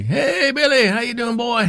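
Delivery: put-on big, high-pitched Southern accent; hammed-up Southern good-old-boy greeting; Charlie. Southern boss hails Billy on the phone, hammed-up drawl. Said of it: "he's real Southern accent".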